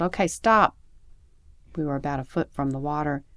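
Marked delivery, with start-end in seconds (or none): 0.0-0.8 raised-voice yell to halt; sudden yelled order. A narrator's reading. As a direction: call out to stop, louder. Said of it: "when she yelled".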